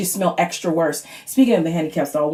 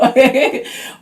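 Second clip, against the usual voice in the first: breathy laugh; 5 semitones higher, 4 dB louder than usual. Real, not acted. Laughs at own story.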